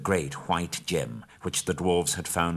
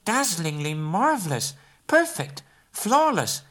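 much higher and louder, gushing praise; exclaiming in awe; 5 dB louder, slower than usual. A narrator's reading. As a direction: overdo the amazement to flatter. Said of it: "exclaimed Bilbo aloud".

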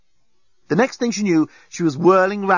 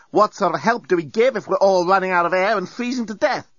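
higher, exasperated woman's voice; Lucy arguing; 6 semitones higher than usual. Acted. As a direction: argue back, exasperated.

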